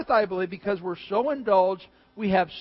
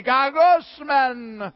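very slow, louder groaning gagusman; exasperated groan; 5 semitones higher, 6 dB louder, much slower than usual. Pretend. Stuck behind a slow driver, drawn-out exasperated groan. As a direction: stretch gagusman out in disgust.

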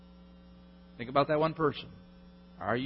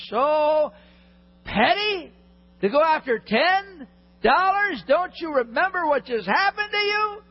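high, loud and drawn-out scolding; preacher scolding the foolish servant; exasperated rebuke; 14 semitones higher, 5 dB louder, slower than usual. Acted. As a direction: build your disbelief into a rebuke.

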